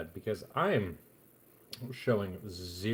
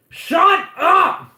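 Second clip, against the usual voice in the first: long, high, loud drawn-out yell; dramatic shout; 18 dB louder than usual. Shown as a demonstration. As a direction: belt it out dramatically. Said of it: "I feel like it's, uh, more dramatic".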